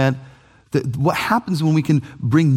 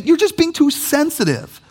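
dismissive retort; 12 semitones higher than usual. Retold or imitated. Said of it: "We say".

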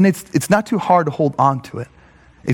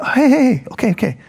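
soothing God; 9 semitones higher than usual. Retold or imitated. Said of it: "God is saying".

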